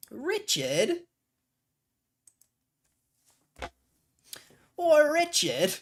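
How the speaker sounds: very high mock British call; British accent. Calling for his lost alter ego, hammed-up British accent. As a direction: call out in a squeaky put-on English accent. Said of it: "I used to have an alter ego".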